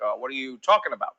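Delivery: low, measured presidential voice; Barack Obama. Acted. English learner answers a challenge, still sounding like Barack Obama. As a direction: answer puzzled in the borrowed deep cadence. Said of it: "you're doing the Barack Obama thing".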